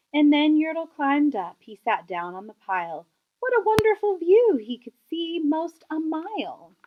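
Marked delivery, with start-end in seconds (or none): 3.4-4.6 a higher, louder burst of wonder; delighted. A narrator's reading. Narrator marvels at the view, delighted. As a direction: gasp at the view from the top. Yertle reaches the top.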